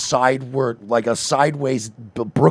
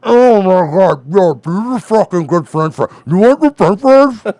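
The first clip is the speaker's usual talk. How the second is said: higher, louder, slow gushing fake enthusiasm; mock normal fry eater; 4 semitones higher, 5 dB louder than usual. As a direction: overdo the delight like a bad actor. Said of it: "it's always".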